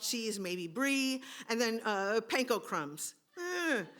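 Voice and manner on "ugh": disgusted ugh. Acted. Disgusted groan.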